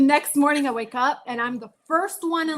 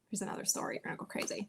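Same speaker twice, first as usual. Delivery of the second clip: whisper; 12 dB quieter, much faster than usual. Real, not acted. Aside teasing the next story.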